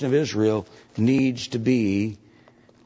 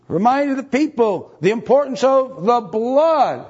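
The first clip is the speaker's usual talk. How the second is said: preaching at full force; 11 semitones higher, 5 dB louder than usual. Acted. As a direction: proclaim it like a climax. Preacher climax on blood.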